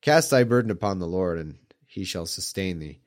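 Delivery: normal talking voice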